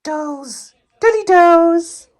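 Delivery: high slow coo of her name; high cooing of a pet name. Real, not acted. Calling dog.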